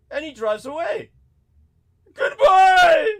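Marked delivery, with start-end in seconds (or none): 2.1-3.1 high, loud parting wail; mock tearful shout. Crying driver pulling away from passenger, mock tearful wail. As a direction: bawl the farewell, hammed-up.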